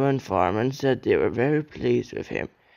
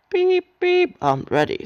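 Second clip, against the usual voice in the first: cheerful whistle; 5 dB louder than usual. A narrator's reading. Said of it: "said Edward".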